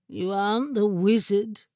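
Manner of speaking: lightly voiced gloomy chair; a touch of doleful grievance; mournful. A narrator's reading. Chair objects to the familiar sitting on it, mildly mournful. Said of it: "the mournful voice continued".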